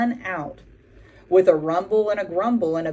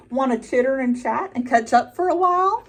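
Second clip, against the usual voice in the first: crow voice; 6 semitones higher, 4 dB louder than usual. A narrator's reading. Friendly crow greets the buffalo.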